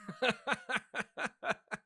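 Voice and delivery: high-pitched real laughter; laughing. Real, not acted. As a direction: crack up in a long, high laugh. Laughing at Vine gag.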